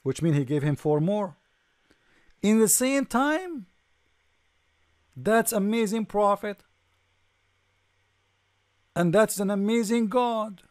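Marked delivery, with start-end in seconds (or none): 2.4-10.6 high, slow, sarcastic wonder; mock-amazed praise; sarcastic amazement. Real, not acted. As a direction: gush with mock awe over the same time.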